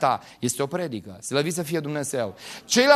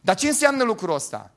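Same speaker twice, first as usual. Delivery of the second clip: ordinary talk, nothing marked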